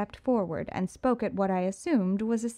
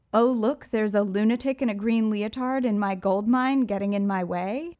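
Riddler voice; 2 semitones higher, 5 dB louder than usual. Acted. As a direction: play the smug showman. Riddler tells the punchline.

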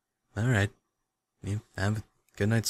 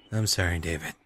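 normal talking voice

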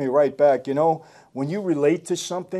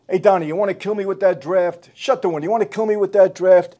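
louder grumbling Lefty impression; mock gripe; 4 semitones higher, 6 dB louder than usual. Lefty griping to Donnie about the draft, mock loud. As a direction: snap at Donnie half-jokingly. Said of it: "he said".

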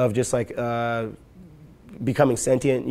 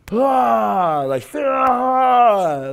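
long, high, loud "aah" whoosh; loud imitated shove noise; 11 semitones higher, 8 dB louder, much slower than usual. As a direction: sound out the big shove with a drawn-out cry. Imitating a full-hand shove.

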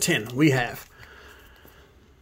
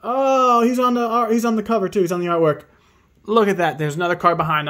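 higher and louder, thrilled; excited; 7 semitones higher, 5 dB louder, faster than usual. Real, not acted. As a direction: gush over the artwork. Excited over the shiny art.

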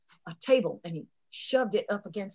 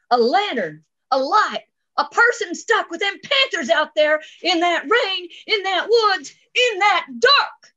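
narration climbing higher and louder; mounting alarm; 9 semitones higher, 6 dB louder, slower than usual. A narrator's reading. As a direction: build urgently phrase by phrase, stretching the last. Dread builds as lantern is sighted.

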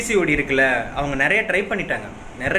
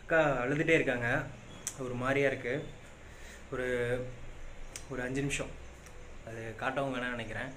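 much softer, hushed so the crying sister is left alone; 3 semitones lower, 15 dB quieter than usual. Real, not acted. Brother hushing his voice as his sister cries nearby, hushed. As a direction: drop to a hush, almost under the breath.

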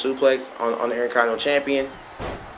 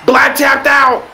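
high, loud, slow yell; excited shout; 11 semitones higher, 7 dB louder, slower than usual. Real, not acted. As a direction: shout the result in disbelief. Champion taps out in match.

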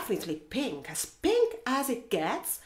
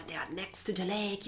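usual speaking voice

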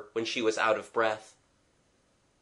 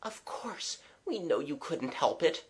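breath-soft, then gentle and higher; soft reassurance; 5 semitones higher, 6 dB quieter than usual. Acted. Kathleen forgiving the young man, softly reassuring. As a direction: comfort him very softly.

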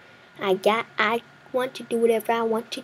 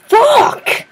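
very high, loud outburst; real angry scream; furious yell; 13 semitones higher, 9 dB louder than usual. Real, not acted. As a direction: explode in frustration. Just got eaten.

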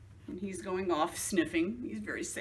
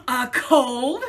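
surprised; 4 semitones higher, 8 dB louder than usual. Acted. Gerald surprised.

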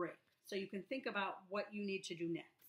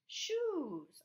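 Shoes said high and a little louder; bright reveal; 10 semitones higher, 5 dB louder than usual. Shown as a demonstration. Teacher to pupils, cheerful high reveal of the word. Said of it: "S H says sh when it's together".

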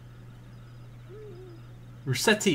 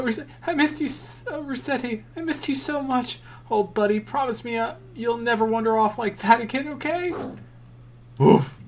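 voicing a tender kid; 9 semitones higher than usual. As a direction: coo to the dog as the happy kid. Kid reunites with dog.

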